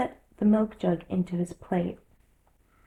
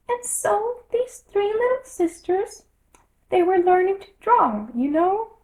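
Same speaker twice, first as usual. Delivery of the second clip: Dormouse voice; 12 semitones higher, 5 dB louder than usual. A narrator's reading. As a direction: drag it out like a drowsy little creature. Dormouse continues story.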